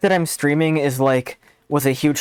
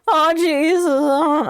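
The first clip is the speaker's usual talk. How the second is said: put-on high, slow, stammering teen voice; whiny Morty groan, drawn out; Morty impression; 13 semitones higher, much slower than usual. Streamer doing Morty at friend's hint, high whine. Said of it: "doing a Morty impression".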